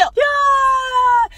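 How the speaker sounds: long, high, loud cheering yell; sustained high-pitched "yaaa" shout; crowd scream. Pretend. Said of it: "소리 한번 질러보세요".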